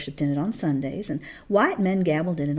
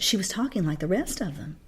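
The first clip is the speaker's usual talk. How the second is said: ordinary delivery, nothing marked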